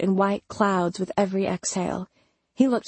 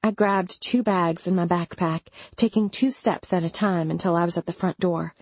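usual speaking voice

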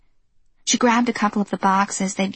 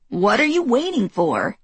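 scolding; 3 semitones higher than usual. Acted. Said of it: "she scolded".

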